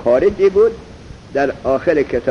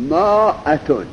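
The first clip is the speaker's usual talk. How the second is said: usual speaking voice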